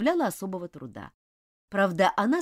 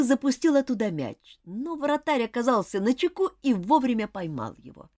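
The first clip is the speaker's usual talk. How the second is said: ordinary delivery, nothing marked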